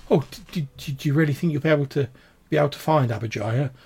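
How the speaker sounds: hopeful but tearful wife, words tripping; distraught village woman. Acted. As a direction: falter through fragile hope.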